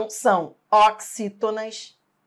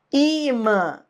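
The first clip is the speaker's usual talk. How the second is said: Imã with a high, strong I and a lighter MÃ; stress on first syllable; 5 semitones higher than usual. Shown as a demonstration. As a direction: lean on the opening I of Imã. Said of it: "identificar a sílaba forte". (Imã com força no I inicial)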